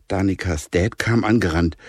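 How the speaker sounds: ordinary delivery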